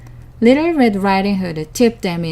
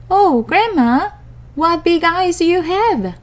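put-on higher innocent child voice; little girl voice; 9 semitones higher than usual. A narrator's reading.

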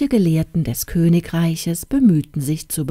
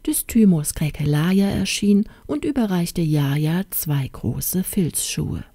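ordinary delivery, nothing marked

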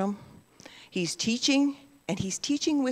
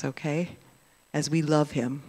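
ordinary talk, nothing marked

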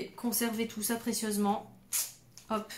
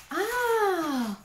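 long, high delighted "ah"; amazed squeal; 9 semitones higher than usual. Real, not acted. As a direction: let out a high cry of wonder. Opens package, amazed. (long cri aigu émerveillé)

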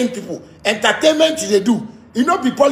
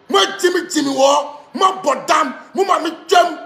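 high-pitched, heated insults; angry tirade; 4 semitones higher than usual. Real, not acted. Insulting a family and company by name, angry. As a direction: hurl each name out in anger, pitch raised.

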